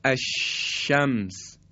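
الشمس very slow with a long unbroken ش; flowing shin demo. Shown as a demonstration. Tajwid teacher showing continuous airflow on shin. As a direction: let the ش in الشمس stream out, slowly.

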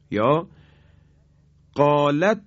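ordinary delivery

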